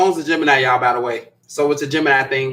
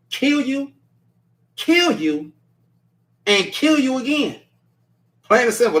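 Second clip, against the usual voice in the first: high-pitched, drawn-out mock threat; imitated sassy threat; 9 semitones higher than usual. Host imagining how the viewer said it, sassy high-pitched threat. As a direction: swoop up and space out each threat. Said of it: "She said it just like that".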